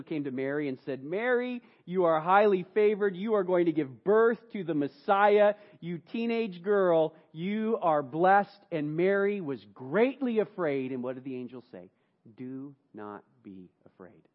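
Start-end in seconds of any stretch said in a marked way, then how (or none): 1.0-8.6 louder, grand announcement, slowing to very slow on the last words; angel's proclamation. Acted. Preacher voices the angel to Mary. 12.3-14.3 much softer and slow; hushed, slow comfort; tender reassurance. Acted. The angel calms a fearful Mary.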